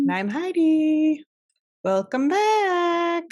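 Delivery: high, drawn-out podcast welcome; sing-song greeting. Real, not acted. Co-host welcomes listeners back, sing-song greeting. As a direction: stretch it out high and bright for the listeners.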